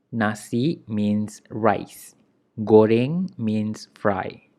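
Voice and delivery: slow, clear explanation of "Nasi" and "Goreng"; slow teaching. Shown as a demonstration. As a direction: spell out each meaning unhurried. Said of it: "teacher explains words slowly".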